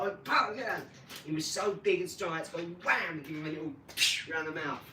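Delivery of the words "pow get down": sharp raised pow, get down; shouting at dog. Retold or imitated. Retelling yelling at the dog by the front door. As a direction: bark it as a command.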